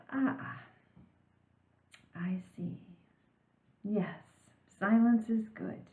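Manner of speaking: hushed, whispered wise master; a hint of a whispering sensei. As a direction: play the movie karate master, soft and wise. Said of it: "imagine what the teacher in the movie The Karate Kid would say".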